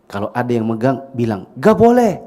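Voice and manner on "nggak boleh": high, loud, sharp no; shouted refusal. Shown as a demonstration. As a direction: yell it as a warning. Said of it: "Coba teriak".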